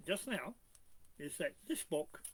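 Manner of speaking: ordinary talk, nothing marked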